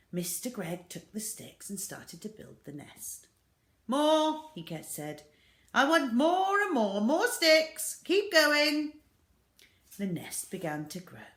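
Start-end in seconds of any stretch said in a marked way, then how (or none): 3.9-9.0 high, loud orders; bossy shout. Acted. Mister Gregg orders his family to fetch sticks, loud bossy call.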